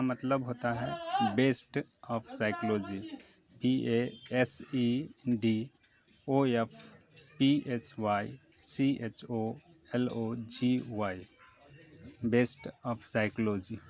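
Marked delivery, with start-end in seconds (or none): 3.6-11.2 slow spelling out. Shown as a demonstration. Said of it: "उसका spelled भी कर रहा हूँ". 12.3-13.8 best of psychology said very slowly, word by word; slow careful pronunciation. Shown as a demonstration. Teacher to students, very slow clear pronunciation. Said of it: "उसका English का उच्चारण भी दे रहा हूँ".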